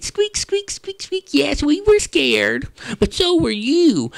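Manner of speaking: very high squeaky mice, teasing back; field mice voice. Acted. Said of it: "the little field mice said".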